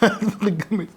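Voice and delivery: laughing through brain freeze; giggling, high and breathy. Real, not acted. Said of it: "انا دماغي جمدت".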